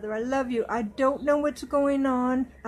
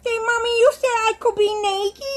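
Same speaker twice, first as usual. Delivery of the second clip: put-on high, louder little-girl voice; childlike doll voice; 9 semitones higher, 6 dB louder than usual. Doll speaking to Mommy, high, louder little-girl voice. Voicing the doll's reply.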